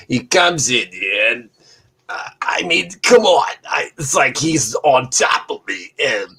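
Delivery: imitating the bear survivor's thick drawling accent. Mimicking the polar bear survivor's thick accent to friends. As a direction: put on his thick accent and tell the bear story. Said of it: "was pretty much exactly how he sounded".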